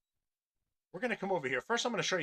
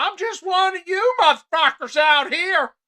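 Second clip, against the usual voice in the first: loud, high mock-Southern caricature; fake pandering accent; 16 dB louder than usual. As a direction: belt out a phony folksy Southern accent. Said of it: "they make up a fake accent".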